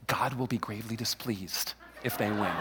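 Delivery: hushed, low mock solemnity; mock-grave warning. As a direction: drop to a low, ominous hush.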